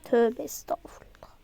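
soft unvoiced whisper; whispered exasperation. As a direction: sigh it under your breath. Exasperated under her breath.

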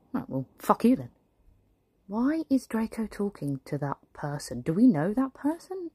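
normal talking voice

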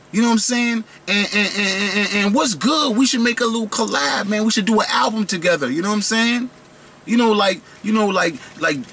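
high, excited brand-collab chatter; hyped fanboy gum voice. Acted. Trident pitches Swedish Fish on an album, high hyped voice. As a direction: play a candy begging for a collab. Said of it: "They sat down next to each other and was like".

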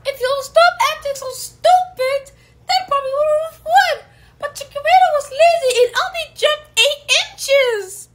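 high, squeaky cartoon dog voice. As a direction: talk like a little cartoon dog. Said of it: "I'm gonna use my dog voice".

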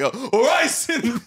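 high mock Jamaican accent breaking into laughter. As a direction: imitate a TikTok Jamaican accent, pitched high. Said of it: "wanted to do the TikTok thing".

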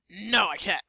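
ordinary delivery

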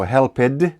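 "helped" wrongly split in two, "hel-ped"; "helped" said as "help-ed", ending in D; mispronounced HELPED. Shown as a demonstration. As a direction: sound out the E in "helped" as a second syllable. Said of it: "Ми не кажемо helped".

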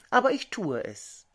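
usual speaking voice

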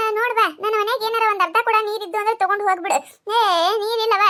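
ordinary talk

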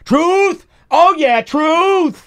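loud, high hype-man shout of approval; imitating a loud audience member yelling along; mock heckler yell. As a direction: bark it out like a rowdy heckler agreeing. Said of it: "He would tag it with".

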